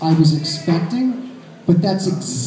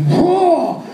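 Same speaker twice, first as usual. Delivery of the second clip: mock whoa; 9 semitones higher than usual. Tackling her in hallway.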